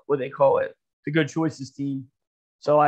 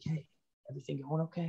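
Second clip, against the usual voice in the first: soft mom check-in; 15 dB quieter than usual. Retold or imitated. Mom cracks, checks in softly.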